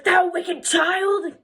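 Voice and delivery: slow, high, loud theatrical outrage; hammed-up furious guardian; scolding witch. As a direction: drag out the scolding in a put-on witch voice. Witch scolds Rapunzel in acted quote.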